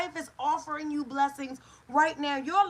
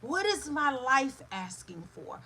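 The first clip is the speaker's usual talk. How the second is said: usual speaking voice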